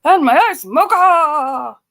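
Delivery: mocking imitation. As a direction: mimic her order, hammed up. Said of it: "S0 parodies S2's line".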